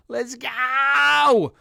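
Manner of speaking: high, loud cheer; hyped cheer. Pretend. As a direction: shout it like a fired-up fan. Cheering the racer on.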